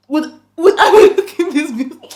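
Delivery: laughing through a loud rhetorical question. Real, not acted. Laughing at scammer's claim.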